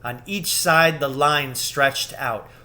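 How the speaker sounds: usual speaking voice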